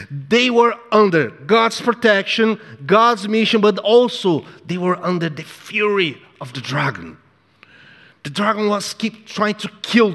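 fiery preaching. Acted. Preacher warning the congregation of the dragon's fury, fiery. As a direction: preach it with rising fire.

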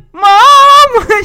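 loud, high mock wail; wailing call. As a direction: cry out for mother like a distraught bride. Bride calls out for mother.

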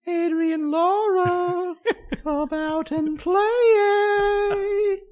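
very high, loud singsong call; Warriors-style taunt. Pretend. Gang calling out Adrian Laura in England, high drawn-out taunt. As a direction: screech it with the last word drawn way out. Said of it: "imagining a Warriors-esque encounter".

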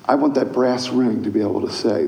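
normal talking voice

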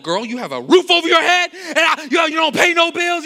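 loud, high mock scolding; exasperated dad rant. A father scolding his daughter about bills, loud mock exasperation. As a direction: let rip like a fed-up parent. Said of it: "Everything within me wanna be like".

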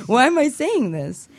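ordinary talk, nothing marked

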